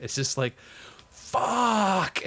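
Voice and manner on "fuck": drawn-out, high cry of anguished rage; mock fan meltdown; raw anger. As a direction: imitate a devastated fan's long curse. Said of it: "raw anger moments ever caught on tape".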